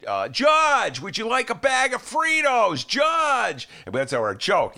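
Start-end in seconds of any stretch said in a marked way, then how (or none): 0.3-3.7 higher, louder, slow over-nice host; mock Biden being too nice to a judge; Joe Biden impression. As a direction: fawn over the nominee. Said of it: "he'd be so".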